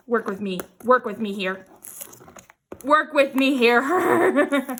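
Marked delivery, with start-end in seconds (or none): 2.8-4.8 slow, louder plea breaking into a laugh; exasperated plea. Real, not acted. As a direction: drag it out loudly, laughing at the struggle. Struggling with stubborn cling wrap.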